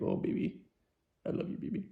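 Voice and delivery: put-on breathy high whispered baby talk; hushed mock-romantic whisper to a partner; mushy whisper. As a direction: coo it like a lovesick sap. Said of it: "You're like".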